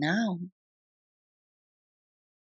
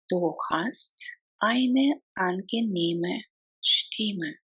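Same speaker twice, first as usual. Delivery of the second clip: slow, drawn-out, separated words. Shown as a demonstration. Teacher repeats a compliment for learners, word by word. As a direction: take the compliment one word at a time. Language drill, broken into words.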